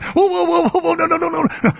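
high, urgent whoa-whoa-no; frantic referee. Retold or imitated. Referees running to overturn the wrong call, frantic high protest. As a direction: rush in waving it off.

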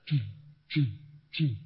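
short punching "choo" of a stamping press; evenly spaced machine puffs; imitated press noise. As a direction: mimic a press stamping in steady beats. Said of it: "A press goes".

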